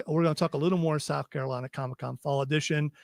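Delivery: usual speaking voice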